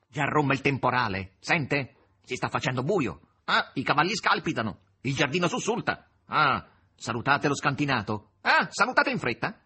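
loud, excited urging; punchy, loud calls to leave at once; urgent summons. A narrator's reading. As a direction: hurry them out with rising excitement. Azazello urges them to leave.

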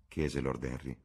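ordinary delivery

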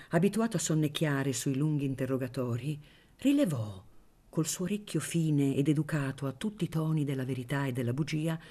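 plain reading voice

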